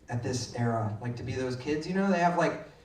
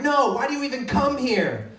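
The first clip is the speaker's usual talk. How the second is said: higher-pitched imitation of a mouthy child; defiant kid; 6 semitones higher than usual. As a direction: challenge the teacher like a stubborn kid. Defiant kid challenges teacher.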